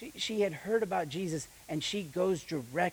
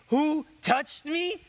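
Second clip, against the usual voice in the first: slow, high and louder mocking question; sarcastic disciples; 11 semitones higher, 6 dB louder, much slower than usual. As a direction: throw his words back at him sarcastically. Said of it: "You see the crowd pressing in on you".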